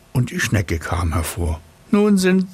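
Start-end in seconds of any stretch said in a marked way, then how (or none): 1.9-2.5 high, self-important snail; a smug little snail, pitched up. Acted. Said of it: "sagte sie".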